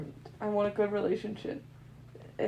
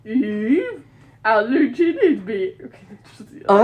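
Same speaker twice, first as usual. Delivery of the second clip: laughing; 12 dB louder than usual. Real, not acted. Breaks into laughter.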